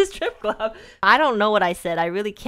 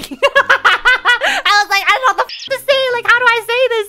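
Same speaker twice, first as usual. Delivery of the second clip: very high, loud squealing laughter through the words; laughing hard; 14 semitones higher, 9 dB louder than usual. Real, not acted. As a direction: squeal it out while cracking up. Laughing while retelling her panic.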